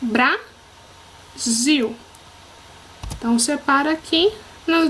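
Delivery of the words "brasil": Brasil as Bra, long pause, zil; syllable by syllable. Shown as a demonstration. Teacher showing two syllables, Brasil split with a pause. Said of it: "nós temos duas sílabas".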